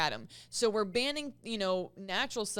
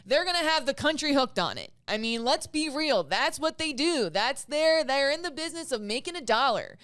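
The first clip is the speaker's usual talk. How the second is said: louder and higher, fired up; heated rant; 6 semitones higher, 7 dB louder than usual. Real, not acted. Host railing at drug companies to her guest, fired-up and loud. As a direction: hammer the point home with outrage.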